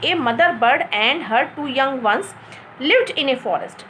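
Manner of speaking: slow reading, stopping after "ones" before "lived in a forest"; pause between blocks. Shown as a demonstration. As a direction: read "A mother bird and her two young ones" as one block, then pause. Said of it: "तो यहाँ पर हम रुकेंगे". ("ones" पर रुककर धीरे पढ़ना)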